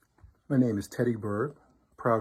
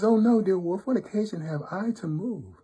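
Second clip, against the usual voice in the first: put-on high, louder little-girl voice; innocent little daughter; 8 semitones higher, 4 dB louder than usual. A narrator's reading. Little daughter denying to the wolf that she moved, high innocent voice. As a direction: protest innocence in a high sing-song child voice. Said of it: "And she says".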